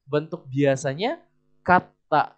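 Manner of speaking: usual speaking voice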